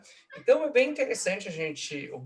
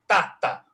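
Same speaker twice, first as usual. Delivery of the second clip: loud, higher "tá, tá"; trochee beat; 5 semitones higher, 6 dB louder than usual. Shown as a demonstration. Lecturer pointing out the trochee, loud "tá, tá". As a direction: hit the first "tá" of the pair. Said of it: "Ó lá, troqueu".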